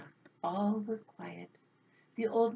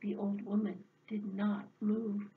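ordinary delivery, nothing marked

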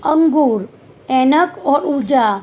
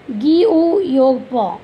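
jumbled letters read out; much slower than usual. Shown as a demonstration. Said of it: "क्रम में लिखकर अर्थपूर्ण शब्द बनाने हैं".

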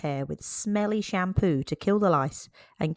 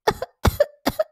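rough, hacking smoker's cough; wicked cough. Acted. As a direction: cough like a boy who sneaks cigarettes. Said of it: "He had a wicked cough".

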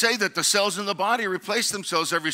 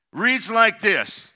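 ordinary delivery

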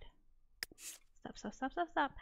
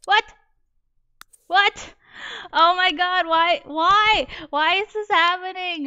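loud, higher, despairing wail; distressed outcry; 6 semitones higher, 9 dB louder, slower than usual. Real, not acted. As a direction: cry it out in loud disbelief. Date goes wrong, she panics.

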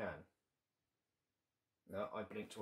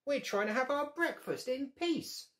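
high, louder squeak of a cross little dad; squeaky ladybird; 13 semitones higher, 3 dB louder than usual. Acted. Daddy ladybird scolds the staring bear, high squeak. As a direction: squeak it up like a tiny father. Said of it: "squeaked the daddy ladybird".

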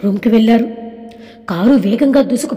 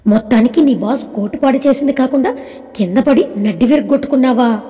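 usual speaking voice

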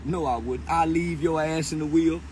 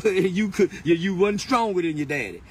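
ordinary delivery

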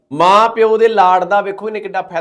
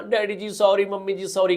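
softer, sugary mock apology; mock sweet apology; 6 dB quieter than usual. Teenage daughter softly mocks sweet sorry to parents. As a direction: mimic a wheedling daughter, softly. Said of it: "ਬੜੀਆਂ ਮਿੱਠੀਆਂ ਮਿੱਠੀਆਂ ਗੱਲਾਂ".

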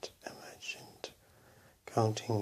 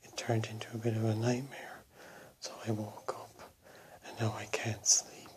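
ordinary talk, nothing marked

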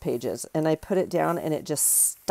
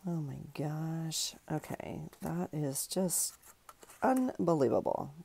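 hushed amazement; 8 dB quieter, slower than usual. Real, not acted. Amazed the piece fits.

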